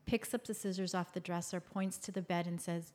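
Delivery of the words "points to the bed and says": usual speaking voice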